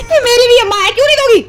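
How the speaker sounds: high, loud laughing protest; mother teasing her stubborn daughter, laughing loud; laughing tease. Real, not acted.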